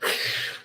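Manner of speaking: brief voiceless laugh; a short laugh, all breath. Real, not acted. Laughs at losing his thought.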